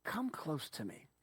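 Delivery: soft, slow, gentle plea; tender invitation. Acted. Joseph inviting his frightened brothers near, soft and slow. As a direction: draw them in softly, slowly. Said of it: "Joseph said to his brothers".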